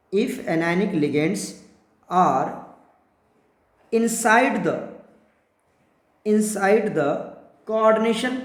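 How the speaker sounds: very slow, word by word; slow dictation. Shown as a demonstration. Teacher dictating slowly to students for notes.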